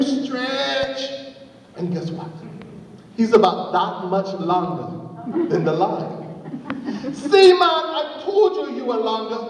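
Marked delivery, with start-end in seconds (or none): 0.0-1.1 straining, higher, elongated STRETCH; stretched-out word. A narrator's reading. Narrator mimes last stretch. 7.1-9.4 excited, higher-pitched told-you-so; triumphant flattery. Acted. Anansi triumphantly flatters the snake.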